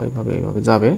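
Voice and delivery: ordinary delivery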